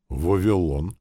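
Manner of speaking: ordinary delivery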